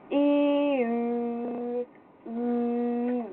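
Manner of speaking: "E" then rounded "U"; ü practice drill. Shown as a demonstration. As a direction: glide "E" into rounded "U". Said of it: "it's I to U".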